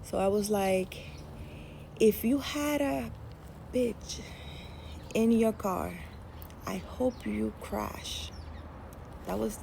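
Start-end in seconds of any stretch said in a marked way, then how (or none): 2.0-8.3 drawn-out threat, dropping away at the end; retold slow curse. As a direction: deliver a measured curse, pausing between phrases. Retold angry curse on the phone.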